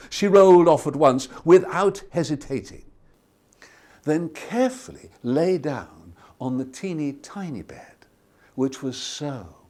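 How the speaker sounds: ordinary delivery, nothing marked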